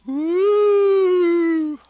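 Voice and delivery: sudden high whoop; mock woo shout. Reader whoops before the creepypasta starts. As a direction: throw out a goofy cheer. Random excited outburst before story.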